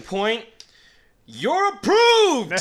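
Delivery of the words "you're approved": high, loud, slow announcement; thrilled, drawn-out reading of the letter; excited. Real, not acted. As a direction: belt out the good news.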